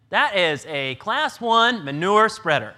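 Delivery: slow, loud punchline to the room; emphatic reveal. Real, not acted. Speaker reveals the manure spreader to the audience, loud and slow. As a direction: land the answer hard and slow.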